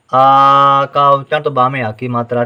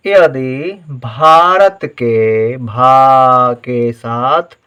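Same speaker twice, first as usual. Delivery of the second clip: slow dictation; much slower than usual. Shown as a demonstration. Teacher dictating words slowly.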